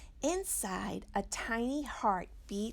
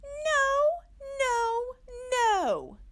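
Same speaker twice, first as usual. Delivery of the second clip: very high, louder repeated no; hammed-up panic; alarmed refusal; 8 dB louder, slower than usual. Mommy rejects the dragon egg, very high and loud.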